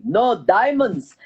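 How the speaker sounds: put-on slow, heavy Russian accent. Submersible pilot in the Titanic movie, heavy Russian accent. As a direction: imitate a Russian pilot, drawn out. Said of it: "heavy Russian accent".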